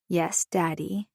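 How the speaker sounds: slow, soft, purring tease; seductive purr. Acted. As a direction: purr it softly and slowly to your husband. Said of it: "then purred".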